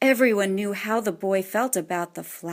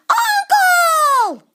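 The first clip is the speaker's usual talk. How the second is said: much higher, louder yell; hollering; 9 dB louder than usual. A narrator's reading. Boy shouting for his uncle about the chewed cable, hollering. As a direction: bellow it at the top of your lungs. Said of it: "he hollered".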